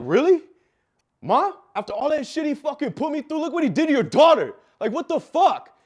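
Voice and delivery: loud, high-pitched disbelieving outburst; acted full-force indignant shouting, voice way up; outraged pleading. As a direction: yell at mom in hurt disbelief. Retold outburst at his crying mother.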